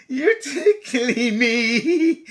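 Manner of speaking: slow, giggling elephant voice; laughing. Acted. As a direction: laugh through the words. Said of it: "gasped the elephant".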